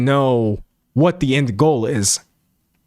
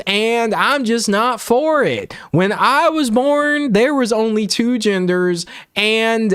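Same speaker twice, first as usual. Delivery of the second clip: slow, higher-pitched hammed-up Southern drawl; terrible Southern accent; 5 semitones higher, slower than usual. Streamer parodies an anti-LGBTQ rant for chat, hammed-up Southern accent. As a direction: put on a bad Southern accent, stretching the words. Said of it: "gonna do a terrible Southern accent".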